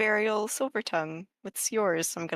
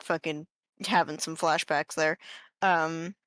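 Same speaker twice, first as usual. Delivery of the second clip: ordinary talk, nothing marked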